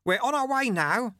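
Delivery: high-pitched call over the wind; shouted. A narrator's reading. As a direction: call out high, to carry over the rushing air. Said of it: "shouted Lutze".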